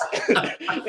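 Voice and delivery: airy giggle way up high; unvoiced high-pitched giggle; high breathless laugh. Real, not acted. Laughing at his own quote.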